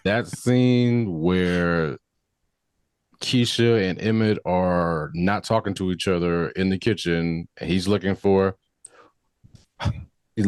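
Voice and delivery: ordinary talk, nothing marked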